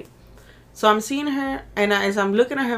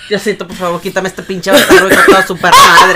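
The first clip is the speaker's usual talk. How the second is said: cursing prayer shouted through laughter; laughing mock prayer; 15 dB louder than usual. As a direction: pray and curse at once, cracking up, getting louder. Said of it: "bien maldiciente y haciendo prayer".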